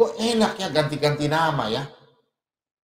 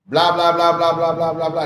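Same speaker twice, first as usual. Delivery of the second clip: mock rambling chatter; 2 semitones higher, 5 dB louder than usual. Retelling how the other man told everything, mock chatter. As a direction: run of louder blah-blahs. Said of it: "Dia cerita semua".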